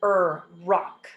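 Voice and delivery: "Er" held alone, then "rock"; isolated R sound. Shown as a demonstration. Teacher sounding out a word, separate "Er, rock". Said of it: "It makes the er sound".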